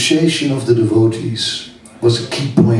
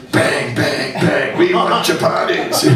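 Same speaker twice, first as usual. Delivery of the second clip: mock chant of impatient restaurant customers, higher pitch; demanding diners; 4 semitones higher than usual. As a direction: imitate a demanding crowd calling for food. Imitates demanding diners banging cups.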